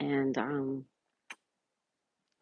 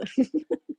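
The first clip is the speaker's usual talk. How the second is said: high laugh; 11 semitones higher than usual. Real, not acted. Reading chat on live stream.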